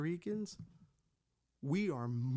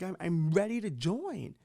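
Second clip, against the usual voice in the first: normal talking voice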